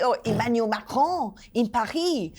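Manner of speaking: Emmanuel Macron in a put-on French accent; lightly hammed French pronunciation; mock French accent. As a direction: say it like a French newsreader would. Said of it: "They don't say".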